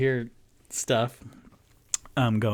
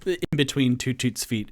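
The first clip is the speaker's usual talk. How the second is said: ordinary talk, nothing marked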